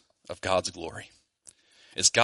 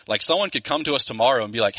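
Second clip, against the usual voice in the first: ordinary delivery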